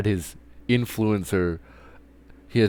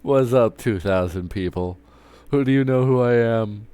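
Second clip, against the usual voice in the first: a touch of pompous announcer, louder and higher; hammed-up self-important hello to everyone; mock big-shot greeting; 5 semitones higher, 6 dB louder than usual. Mock pinging two hundred thousand people.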